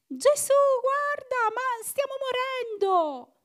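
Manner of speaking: high, loud, slow pleading wail; terrified plea. Retold or imitated. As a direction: beg Jesus to wake up, terrified. Imagining herself as a frightened disciple. (supplica disperata, acuta e forte, lenta)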